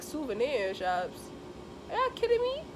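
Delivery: can't believe what they're asking; disbelief. Retold or imitated. To relatives wanting souvenir shops, squeaking in disbelief. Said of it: "I'm like".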